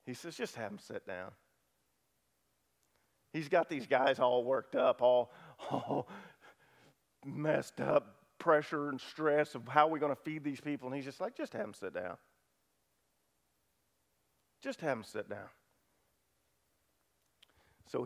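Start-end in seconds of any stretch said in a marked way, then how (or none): 0.4-1.3 much softer and lower, easy and settled; calm and unbothered. Acted. Jesus calmly telling the disciples to seat the crowd. 11.4-15.5 much softer and lower, easy and settled; calm and unbothered. Acted. Jesus calmly telling the disciples to seat the crowd.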